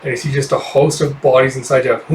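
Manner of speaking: ordinary delivery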